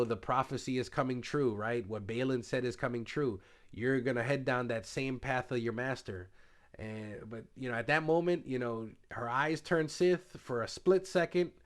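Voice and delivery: ordinary delivery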